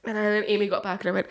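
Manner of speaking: ordinary delivery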